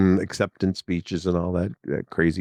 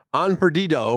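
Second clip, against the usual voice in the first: higher, lightly voiced Pope teasing back; quoting the Pope; 8 semitones higher than usual. Pope answering a heckling Cubs fan, mild teasing in Spanish. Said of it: "the Pope responds".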